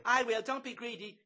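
loud, high falsetto; squabbling Florrie. A narrator's reading. Florrie competes with Zoe for Bloom, squabbling high.

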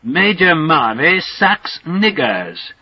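slow, high, hammed-up English accent call; English accent holler. Slothrop hollering provocation down the long tunnel, English accent holler. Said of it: "puts his head back out and hollers".

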